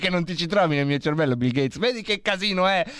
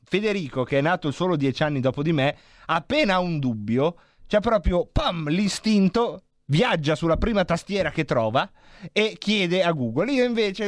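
ordinary talk

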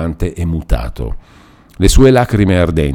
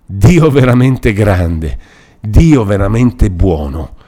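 slow, loud, raised cry of awe; fervent exclamation; 3 semitones higher, 6 dB louder, slower than usual. A narrator's reading. The repentant innominato cries out to God, slow and loud. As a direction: cry out to God slowly, overwhelmed. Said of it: "esclamò".

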